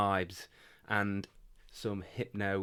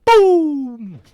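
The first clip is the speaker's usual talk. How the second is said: shouted high boom; loud boom; 22 dB louder than usual. Pretend. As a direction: hit it like a cymbal crash. End of drum-roll intro.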